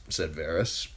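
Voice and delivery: ordinary delivery, nothing marked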